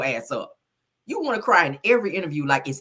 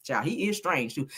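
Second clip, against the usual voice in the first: usual speaking voice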